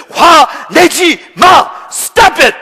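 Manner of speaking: loud, high, one slow word at a time; angry shouted command. Real, not acted. Preacher ordering congregation to stop anger, angry shout. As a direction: hammer out each word at full volume. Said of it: "김 목사님 화내면서".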